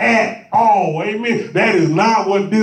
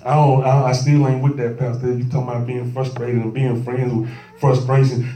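skeptical churchgoer; 7 semitones lower than usual. Acted. Doubting member objects to the pastor, skeptical and low. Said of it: "And some people say".

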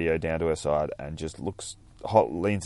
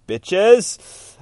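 hyped shout; 13 semitones higher, 11 dB louder than usual. Real, not acted. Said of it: "I'm loving me some".

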